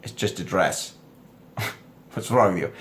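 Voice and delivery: low, soft mock bafflement; unimpressed man. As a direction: drop the pitch and volume, deadpan. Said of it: "And you're like".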